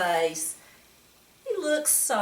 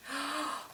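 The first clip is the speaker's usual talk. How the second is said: mock gasp; 7 semitones higher, 9 dB quieter than usual. Reader reacts to cake mess.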